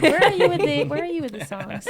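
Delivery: high excited teasing; playful tease. Real, not acted. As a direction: egg her on playfully. Teasing about song quote.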